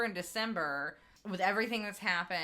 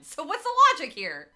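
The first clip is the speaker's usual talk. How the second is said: high, loud disbelief; exasperated; 9 semitones higher, 12 dB louder than usual. Real, not acted. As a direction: throw up your hands at it. Exasperated at mayor's choice.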